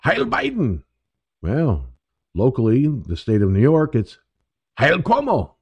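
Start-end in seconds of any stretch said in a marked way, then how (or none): 0.0-0.8 mock rallying cry, much higher; mocking salute. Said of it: "make fun of Biden and go". 4.8-5.5 mock rallying cry, much higher; mocking salute. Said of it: "make fun of Biden and go".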